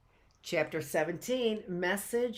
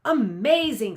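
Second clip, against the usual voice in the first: amazed; 4 semitones higher, 9 dB louder than usual. Acted. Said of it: "jaw dropping open in wonder".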